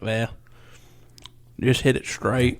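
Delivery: slow, lightly mimicked golfer drawl; a hint of the laid-back young golfer; teasing imitation, slowed down; impression of Moffitt. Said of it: "[name]'s little impression there".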